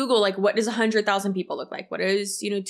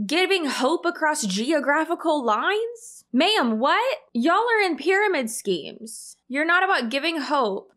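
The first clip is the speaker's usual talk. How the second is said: incredulous disbelief; 6 semitones higher than usual. Real, not acted. Incredulous mocking of speech line.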